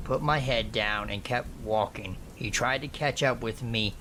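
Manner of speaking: ordinary delivery, nothing marked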